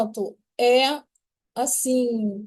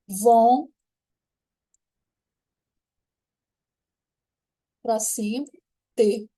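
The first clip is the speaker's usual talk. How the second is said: syllable by syllable dictation; slower than usual. Shown as a demonstration. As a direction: dictate each syllable with long pauses. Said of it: "Então, ficaria". (ditando sílaba por sílaba, com pausas longas)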